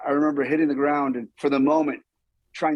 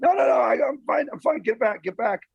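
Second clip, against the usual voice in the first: insistent waving off; 8 semitones higher than usual. Retold or imitated. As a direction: push the medic away urgently, out of breath. Winded stuntman waving off medic.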